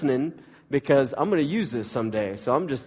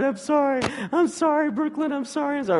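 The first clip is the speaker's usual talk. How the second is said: mock sobbing apology, much higher; imitating a crying child; 14 semitones higher than usual. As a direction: voice a little girl falling apart in tears. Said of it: "she starts crying and falling apart".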